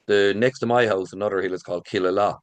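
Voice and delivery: ordinary talk, nothing marked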